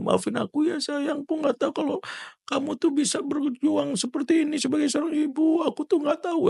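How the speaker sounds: very high, tearful mock sobbing voice; acted crying husband. Husband hugging his wife in apology, acted tearful wail. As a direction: plead like a husband weeping into an apology. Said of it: "Ya gitu, dia nangis".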